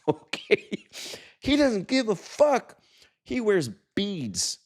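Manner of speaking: laughing high. Real, not acted. Laughing at Russell Brand.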